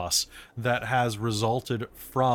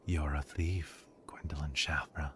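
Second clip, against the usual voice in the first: whispering; 9 semitones lower, 12 dB quieter than usual. Acted. Doll voice threatens the thief.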